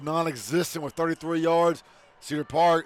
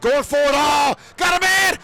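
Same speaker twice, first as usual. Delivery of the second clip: much higher, louder shouted call; excited shout; 8 semitones higher, 5 dB louder than usual. Real, not acted. Announcer calling a long touchdown pass, excited shout.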